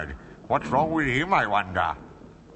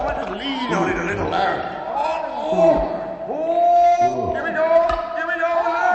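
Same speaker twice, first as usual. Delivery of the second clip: straining effort; 13 semitones higher than usual. Acted. Straining to pull a tooth.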